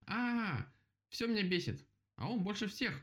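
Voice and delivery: high-pitched irritated outburst; exasperated cry. A narrator's reading. Rabbit driven mad by the woodpecker, high angry cry. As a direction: cry out in high, fed-up annoyance. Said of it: "закричал кролик".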